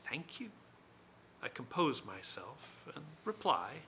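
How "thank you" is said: soft, very quiet thank you rising into a question; incredulous echo. A narrator's reading. The narrator echoes the rival's thanks back as an incredulous question.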